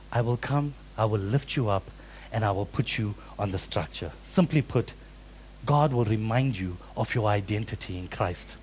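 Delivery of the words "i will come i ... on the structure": much softer, tender promise; soft voice of God. Acted. Preacher voices God comforting the fruitless, soft and tender. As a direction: speak it gently, like God comforting. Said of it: "God is saying".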